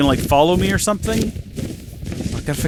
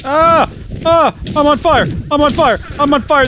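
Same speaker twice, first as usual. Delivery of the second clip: high, loud panicked screams; frantic high shouts, repeating the cry; panicked yelling; 10 semitones higher, 5 dB louder than usual. Real, not acted. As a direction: yell in real panic as you burn. Character catches fire in lava.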